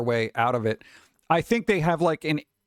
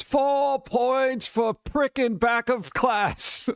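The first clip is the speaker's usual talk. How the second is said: higher, slow headmaster announcement; solemn wizard headmaster, drawn out; Dumbledore voice; 9 semitones higher, much slower than usual. Acted. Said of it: "say it in a Dumbledore voice".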